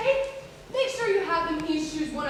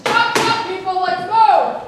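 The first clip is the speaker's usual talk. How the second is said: shouting orders; 11 dB louder, slower than usual. Real, not acted. Producer yelling at crew.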